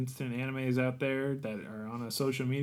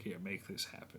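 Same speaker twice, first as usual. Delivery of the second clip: whispered; 7 semitones lower, 13 dB quieter than usual. Pretend. Quoted aside.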